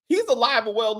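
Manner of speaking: ordinary talk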